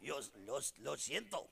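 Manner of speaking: laughing, faking he speaks only Spanish; mock Spanish. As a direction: play dumb in put-on Spanish. Feigning not understanding, joke.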